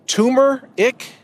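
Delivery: "tumor-ick" said like tumor plus ick, first r dropped; imitating a mispronunciation. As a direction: mimic people saying "tumor-ick". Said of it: "People like to say".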